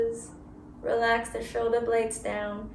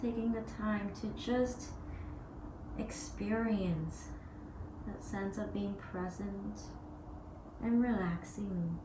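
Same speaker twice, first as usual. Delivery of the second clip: guided meditation; 7 dB quieter than usual. Acted. Yoga teacher guiding students through breath, soft meditation voice. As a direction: ease them into each pause.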